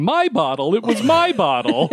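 teasing impression of the wife claiming the bottle; mock wife. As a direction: act the wife grabbing back her bottle. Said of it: "My, my wife's behind us going".

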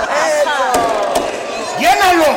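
loud, high whoop; hyped shout at the friend filling the glass; excited cheer. Real, not acted. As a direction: cheer on the pour. Cheering while glass is filled.

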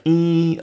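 high, loud sustained "ee"; imitated buzzer. Pushing the buzzer and not letting go, high drone. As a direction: hold a high buzzing vowel.

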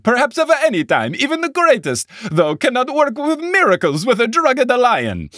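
high-pitched, hammed-up Italian accent; cartoonish Italian. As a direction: boast like a cartoon Italian lion tamer. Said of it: "cartoonishly Italian".

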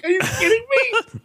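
very high, laughing near-shout; laughing disbelief. Retold or imitated. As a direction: shriek through laughter at the mix-up.

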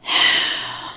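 slow, heavy breath out in disappointment; long deep sigh. Retold or imitated. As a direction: sigh as the weary father. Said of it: "long, deep sigh".

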